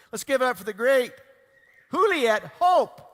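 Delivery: big, loud stage introduction, stretching the name; hype announcer intro. Real, not acted. Emcee bringing the guest on stage, loud announcer hype. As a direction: pump up the crowd for the guest.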